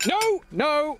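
high, desperate cry of no; a frantic refusal, shouted high; panicked shout. Acted. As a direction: yell it out in panic. Jack panics at the animals.

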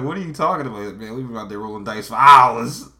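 imitated exasperated yelling; upset gambler. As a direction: act out a loser protesting at full volume. Acts out an upset losing gambler.

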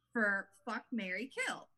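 ordinary delivery

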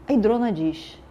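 ordinary delivery

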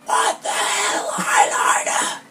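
slow, breath-only whisper yell; stage whisper. Shown as a demonstration. Reader demonstrating for co-host, slow whispered yell. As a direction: stretch it out in an exaggerated stage whisper. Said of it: "She, she stage whispered".

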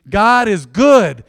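loud, slow, drawn-out proclamation of God is good. Acted. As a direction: lean in and preach it out slowly. Preacher proclaims sermon theme.

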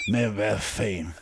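normal talking voice

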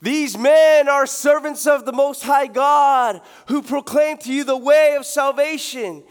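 loud, slow proclaiming shout; booming slow proclamation; crying out. Retold or imitated. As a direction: cry it out like a possessed herald.

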